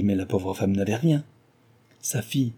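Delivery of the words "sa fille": plain reading voice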